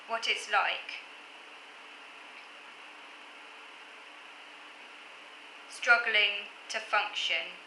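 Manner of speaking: deliberate poem reading with long pauses; poem recitation. Acted. Poet rereading own crossed-out handwriting to viewers, halting poem recitation. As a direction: pick the verse back up, measured and halting.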